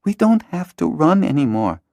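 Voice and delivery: put-on high girl's voice, gentle plea; a girl pleads with her friend to stop running; pleading girl. Hala continues pleading.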